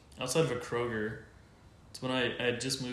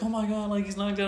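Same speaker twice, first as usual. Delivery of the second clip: high-pitched imitation of a shocked girl; alarmed daughter; 10 semitones higher, 5 dB louder than usual. Daughter seeing her boyfriend knocked out, mock alarm.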